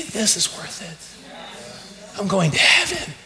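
breathless whispered vow. Acted. Believer quietly vowing after hardship, whispered. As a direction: hush it like a secret promise. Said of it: "you say".

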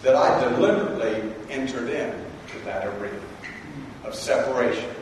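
Jesus, low and quiet, to Sheridan; Jesus speaking. Retold or imitated. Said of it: "And Jesus is saying".

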